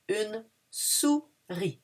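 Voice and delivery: "une" pressed, "souris" slow; slow, marked "Une"; stress on une. Shown as a demonstration. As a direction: lean on "une" to show the feminine. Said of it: "but it's une".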